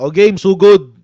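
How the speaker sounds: loud barked order to charge; commander's call to attack. Retold or imitated. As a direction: shout it like a commander sending troops in. (sigaw ng kumander na sumugod)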